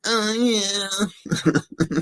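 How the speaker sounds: very high breathy falsetto moan; porn moan imitation.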